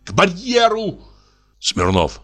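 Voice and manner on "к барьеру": higher, defiant cry; shouted challenge; shouting. Acted. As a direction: demand the duel. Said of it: "Попова кричит".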